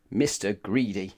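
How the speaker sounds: plain reading voice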